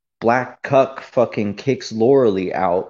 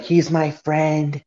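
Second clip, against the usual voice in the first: higher, drawn-out mocking woman's voice; mock crackhead; 5 semitones higher, slower than usual. Imitating a woman standing up for her friend, mock crackhead voice. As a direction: stretch it slowly in a high crackhead impression. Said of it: "she sounds like a crackhead".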